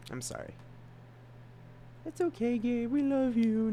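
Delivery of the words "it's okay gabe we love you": pitched-up reassurance; squeaky comfort. Acted. Comforting self in high voice.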